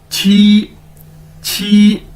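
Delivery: छी with an aspirated ch sound; Chinese qi. Shown as a demonstration. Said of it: "क्यू असर को हम छह के तौर पर प्रोनाउंस करते हैं".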